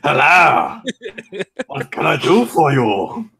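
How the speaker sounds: high, loud comic helper voice; jokey put-on greeting. Game master playfully greeting a player, silly and laughing.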